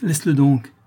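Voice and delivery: impatient, dismissive order; brutal interruption. A narrator's reading. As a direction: cut in roughly. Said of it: "reprit brutalement Malicorne".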